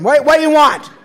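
high, loud hallucinated shouting; hollering. Retold or imitated. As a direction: bellow it like a voice from the speaker box. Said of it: "somebody started hollering at me".

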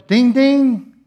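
high, loud bell chime; imitated bell. Release bell ringing at the top, high loud imitation. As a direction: ring out two bright syllables like a bell. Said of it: "that bell goes".

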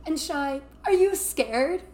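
Larry ribbing the health aide, slow teasing voice. Retold or imitated. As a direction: drag it out to tease a nervous girl.

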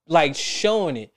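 usual speaking voice

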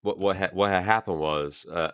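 normal talking voice

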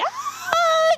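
long high-pitched "Ah!" scream; mock overjoyed mother's scream; mother's imitated scream. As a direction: let out a drawn-out, very high shriek. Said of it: "ekspresi mama saya gimana yang teriak".

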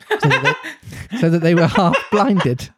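reading through giggles, pitch jumping; laughing while reading. Real, not acted. Reader amused by Slightly's lantern, laughing through the line. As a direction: let the laugh break into the words. Reader cracks up mid-sentence.

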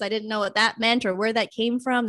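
ordinary talk

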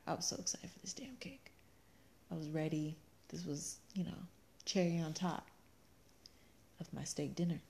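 hushed longing. Real, not acted. Wife wistfully recalling cake.

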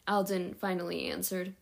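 usual speaking voice

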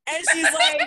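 high laugh through the words; laughing while retelling the roommate. Real, not acted. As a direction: crack up mid-story.

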